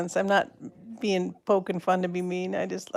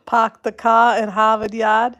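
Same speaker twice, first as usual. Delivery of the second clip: louder Boston accent with dropped Rs; playful Boston accent; 2 semitones higher, 5 dB louder than usual. Shown as a demonstration. Vlogger tries how a viewer talks, playful Boston accent. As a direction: do a hammed-up Boston "pahk the cah". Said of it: "you're gonna get me talking that way".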